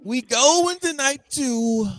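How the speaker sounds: slow, high ring-announcer build; stretched hype announcer call. Acted. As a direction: announce it like a big match intro.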